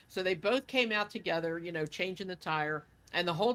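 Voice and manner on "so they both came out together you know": ordinary talk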